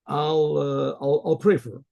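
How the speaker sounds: plain reading voice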